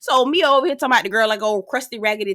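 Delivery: usual speaking voice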